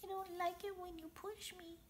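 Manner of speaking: frightened tiny whisper. Acted. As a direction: barely dare to say it. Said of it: "whispers in a tiny little voice".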